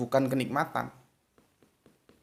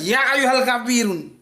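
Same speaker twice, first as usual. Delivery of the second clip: high, loud, firm declaration; 9 semitones higher, 4 dB louder than usual. Shown as a demonstration. Teacher showing how the surah should sound, firm and loud. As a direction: proclaim it decisively, no sing-song. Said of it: "Dia harus tegas".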